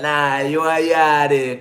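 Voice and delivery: slow, loud Jamaican insistence; Jamaican accent. Retold or imitated. Jamaican refusing to believe the denial, imitated Jamaican accent. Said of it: "they will be like".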